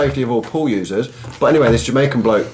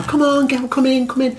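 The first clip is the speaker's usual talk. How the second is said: high, lightly voiced girls urging a friend; mock-coaxing schoolgirls; teen girls coaxing; 13 semitones higher than usual. Retelling the pool story, imitating friends coaxing a girl into the water.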